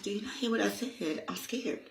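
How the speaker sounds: half-joking frightened protest; playfully scared; scared plea. Retold or imitated. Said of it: "It's like".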